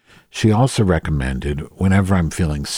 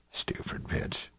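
whispered under the breath; muttered insult; 18 dB quieter, slower than usual. Real, not acted. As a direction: toss it away as a barely audible aside. Muttered aside while searching.

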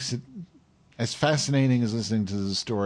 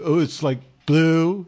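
mild stoner dude, higher and louder; Spicoli-ish friend; 8 semitones higher, 9 dB louder, slower than usual. Retold or imitated. Friend excited about the blue meal, mild stoner voice. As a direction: play the stoned surfer, a bit high. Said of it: "kinda talks like Spicoli".

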